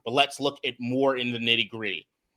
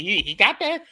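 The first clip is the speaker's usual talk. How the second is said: high, louder burst of amazement; excited disbelief; 13 semitones higher, 6 dB louder than usual. Real, not acted. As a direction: marvel at it, pitch jumping up. Marveling at the player's recovery.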